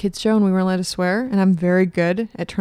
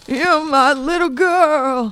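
slow, lightly voiced old man; 6 semitones higher, much slower than usual. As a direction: try out a creaky grandpa voice. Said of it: "find my old man voice".

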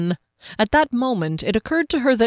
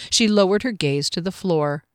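ordinary delivery, nothing marked

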